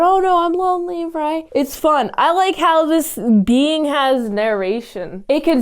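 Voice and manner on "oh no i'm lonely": high, quick mock plea; lonely alien calling out. Reviewer voices the magnetic being left behind, mock lonely call. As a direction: put on a high voice for the abandoned being. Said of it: "as it calls out".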